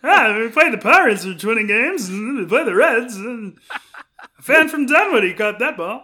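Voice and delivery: loud, hammed-up old baseball radio announcer; Skip Carey impression. Mocking a baseball broadcaster, a loud hammed-up announcer call. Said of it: "a knucklehead impression of Skip Carey".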